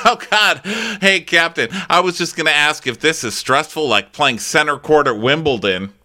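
high, loud, intrusive chatter; mock eager interrupter. Gigi pestering the captain mid-docking, mock eager. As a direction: butt in brightly at a high pitch.

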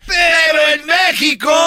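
high, stretched-out catchphrase; hammed-up announcer call. Comedian introduces the Mexican version, high drawn-out call. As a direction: sing it out to the crowd.

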